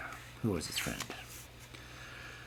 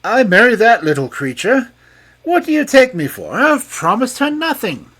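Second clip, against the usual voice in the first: much higher, louder indignant king; outraged king; 13 semitones higher, 11 dB louder than usual. A narrator's reading. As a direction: refuse with outrage. King refuses the marriage.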